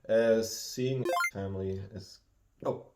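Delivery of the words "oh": ordinary talk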